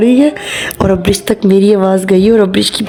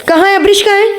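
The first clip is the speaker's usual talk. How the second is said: high, pressing question; mock-anxious, pitched up; urgent call; 10 semitones higher, faster than usual. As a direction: sound worried as you look for her. Searching anxiously for daughter.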